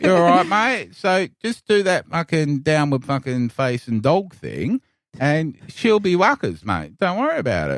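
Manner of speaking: laid-back Aussie yoga teacher, hammed up; broad Australian accent. Australian yoga teacher telling the class to relax, broad accent.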